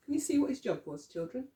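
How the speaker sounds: ordinary delivery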